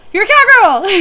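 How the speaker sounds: high, loud cheering shout; nervous guide cheering the little rider; excited cheer. Retold or imitated. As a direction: cheer her up with a burst. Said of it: "in my fear".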